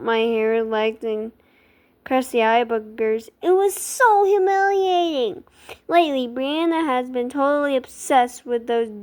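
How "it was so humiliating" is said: put-on dramatic teen mortification, pitched up; mortified. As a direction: wail it in exaggerated embarrassment. Narrator voices the diary writer's embarrassment.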